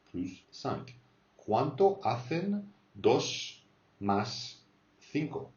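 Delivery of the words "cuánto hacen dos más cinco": slow model question. Shown as a demonstration. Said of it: "teacher models question slowly".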